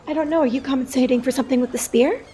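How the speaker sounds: quick, soft, lightly voiced noblewoman; haughty lady teasing, quick and soft; noble woman voice. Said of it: "does her little noble woman laugh and says".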